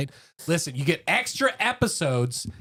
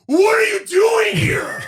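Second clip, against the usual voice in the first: high, loud, slow menacing yell; sleepwalker's scream; 9 dB louder, slower than usual. Retold or imitated. Sleepwalking roommate looms over him, slow high scream. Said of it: "he started repeating it".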